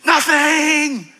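high, ringing declaration; drawn-out shout with attitude. Shown as a demonstration. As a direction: stretch it out as a rallying cry. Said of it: "Say it with attitude".

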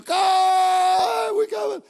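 very high, loud triumphant cry; archangel's shout. Pretend. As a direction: call out like a heavenly herald. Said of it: "the shout of an archangel".

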